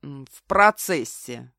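low, slow, noncommittal reply; evasive. A narrator's reading. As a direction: stall a little, keep it vague. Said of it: "обтекаемо ответил он". (уклончиво, неторопливо)

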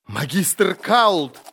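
high, drawn-out shout; cried out. A narrator's reading. Prisoner naming who gave orders, crying out. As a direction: shout the name high and long. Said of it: "немедленно выкрикнул Хорнлах".

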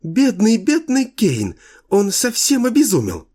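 put-on high ringing childlike voice; sing-song pity like a little girl; cartoon princess. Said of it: "звонким, почти детским голоском".

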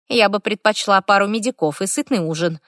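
ordinary delivery, nothing marked

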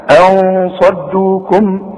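slow recitation with hidden nasal n; tajweed recitation. Shown as a demonstration. Teacher contrasting ikhfa with idgham, slow recitation. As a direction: say أن صدوكم slowly, صاد without shadda, nasal ghunna on أن. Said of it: "شوف، وخد بالك من الصاد بقى".

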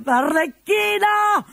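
Pantalone shouting. Acted. Pantalone catching Arlecchino chatting with his daughter, high shouting. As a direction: yell down at the idle servant.

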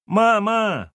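put-on loud, high falsetto child's call; falsetto shout. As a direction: yell for mum in falsetto. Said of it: "фальцетом закричал Тим".

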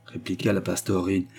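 ordinary delivery

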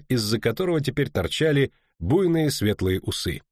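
ordinary delivery, nothing marked

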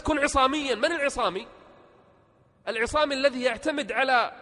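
loud, high-pitched motivational preaching; rousing exhortation. Real, not acted. Preacher urging listeners to rely on themselves, rousing exhortation. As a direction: drive it home in forceful high bursts.

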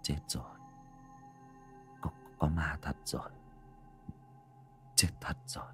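barely voiced, trembling mumble to himself; frightened mutter. A narrator's reading. Father mutters in terror after seeing the ghost soldiers. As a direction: mutter it in shock, almost no voice. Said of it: "mà lẩm bẩm".

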